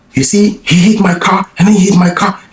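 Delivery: high hammed-up accusation; imitating his yelling. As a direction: call out to the crowd that he hit the car. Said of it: "that's how he was, was yelling".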